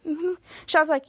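usual speaking voice

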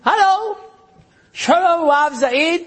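high, eager foreign caller; caller from abroad. Retold or imitated. Caller from Miami greeting the rabbi, high and eager. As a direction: greet like an American on the phone. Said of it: "חוץ לארץ".

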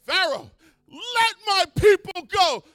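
slow, high, loud demand; Moses shouting. Acted. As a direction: bellow it at the king.

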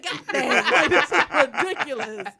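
loud, laughing mock outburst; retold exasperation. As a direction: blurt it out fed up, half laughing. Retold exasperation at grumpy husband.

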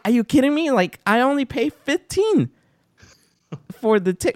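way up high, incredulous and indignant; retold outrage at the counter, high and incredulous; mock outrage. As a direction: replay the disbelief at the fee, pitched high. Retold outrage over ticket fee.

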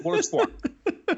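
high, slow, hammed-up sneering voice; mock sneer. Mocking the footballer to his co-host, in a high, slow sneer. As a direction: drag out the insult in a silly high voice.

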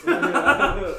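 loud, high laugh-filled oh yeah; a real burst of laughter on oh yeah. Real, not acted. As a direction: laugh through the words for real. Laughing at the B cup joke.

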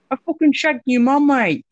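high, loud, rapid British-Australian taunt; hammed-up British or Australian lout; British-Australian accent. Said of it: "Or is that Australian?".

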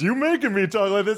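high puppet voice arguing back; hammed-up, high-pitched puppet retort; accusing dummy. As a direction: throw the blame back as the dummy.

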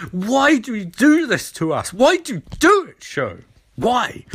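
mock despair, pitch jumping up on the second why; exasperated plea. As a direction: whine at the show as if betrayed. Pleading with the TV show.

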